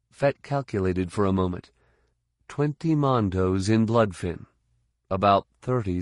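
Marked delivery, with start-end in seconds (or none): none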